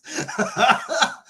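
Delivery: real high giggling laugh; high burst of laughter. Real, not acted. Streamer reacting to a funny comment, cracking up. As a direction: crack up helplessly for a moment.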